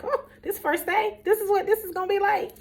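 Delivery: very high, incredulous retold complaint; dismayed disbelief. Dismayed on exhausting first day.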